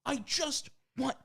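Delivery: high-pitched mock exasperation; exasperated protest. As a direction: whine it up high, word by word. Acted exasperation at the assistant.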